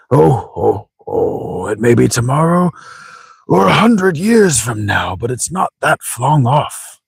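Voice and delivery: put-on old sage with lilting, swooping pitch; chuckle, then muse grandly on the future; theatrical wise old man; chuckling old grandfather. Old man foretells planet's death.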